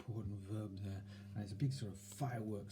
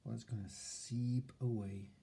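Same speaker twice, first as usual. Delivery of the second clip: softer, hushed, half-whispered calm; soft soothing voice; 6 dB quieter than usual. Shown as a demonstration. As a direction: soothe yourself like a child. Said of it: "See that tone of voice?".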